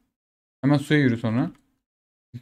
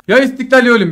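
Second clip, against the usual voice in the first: heroic battle cry; 6 semitones higher, 4 dB louder than usual. Real, not acted. Mock heroic charge cry.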